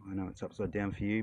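usual speaking voice

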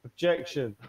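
courtroom objection. Pretend. Judge in a role-play trial, calling out an objection. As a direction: call it out high across the court.

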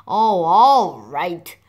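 slow, drawn-out giving in; grudging surrender. Acted. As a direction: give in with an exasperated groan of words. Mommy gives in to begging.

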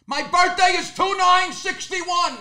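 high, slow shout spelling out the digits; slow bellowed birthday through plexiglass; shouting slowly. Retold or imitated. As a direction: yell it so he finally hears. Shouting at pharmacist who can't hear.

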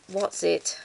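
usual speaking voice

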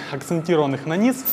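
ordinary delivery

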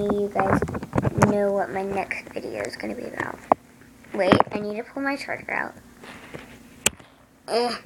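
ordinary talk

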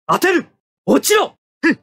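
high, forceful attack shouts; battle cries. Acted. Pilot attacking the enemy mobile suit, high forceful shouts. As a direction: yell them as each shot goes out.

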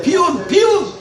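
shouting insults; angry shout. Real, not acted. Old man shouting insults at politicians, angry shout.

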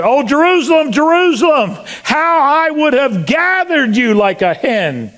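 preacher's full-voiced acted lament; impassioned lament. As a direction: cry out over the city you longed to save. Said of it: "he came to Jerusalem and he said".

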